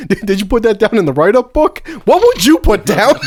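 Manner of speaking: high, teasing, laughing; teasing girlfriend voice. Retold or imitated. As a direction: tease him with a laugh. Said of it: "Selena was like".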